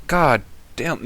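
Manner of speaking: ordinary talk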